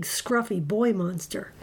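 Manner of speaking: ordinary delivery, nothing marked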